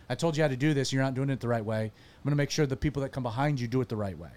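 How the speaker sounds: ordinary delivery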